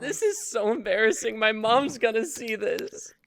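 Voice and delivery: loud, high, rushed overacted embarrassment; hammed-up panic. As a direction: blurt it out like a mortified kid. Redo of the scripted lines.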